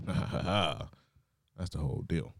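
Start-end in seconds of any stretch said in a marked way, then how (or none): 0.1-0.9 hammed-up villain laugh; mock evil laugh. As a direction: cackle like a scheming gym.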